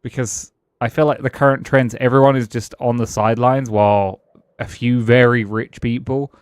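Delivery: ordinary talk, nothing marked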